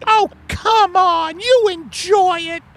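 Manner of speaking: very high falsetto, coaxing wife; seductive Margaret. Acted. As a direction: tease him in a high put-on wife voice. Acted couple skit, wife voice.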